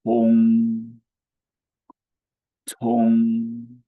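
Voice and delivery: Tong held high, level and loud; first tone demo. Shown as a demonstration. Teacher modelling the word, high level tone. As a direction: hold Tong steady and high. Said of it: "How do you say the go through in Chinese?".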